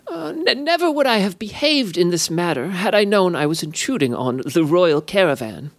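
high, mock-apologetic fey voice; apologetic sprite. A narrator's reading. The sprite apologizes to the Queen, high and insincere. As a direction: feign sorrow with a sly edge. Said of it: "apologetic air".

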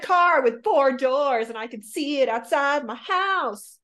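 loud, high, over-eager mock demand; mock wishing. Mocking a specific manifester listing wishes, loud high put-on eagerness. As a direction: belt out a pretend wish list, high and emphatic. Mimics someone making a manifesting list.

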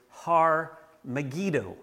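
slow Hebrew phrase Har Megiddo; careful Hebrew. Shown as a demonstration. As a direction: sound out "Har Megiddo" slowly syllable by syllable. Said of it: "a Greek transliteration of a Hebrew phrase".

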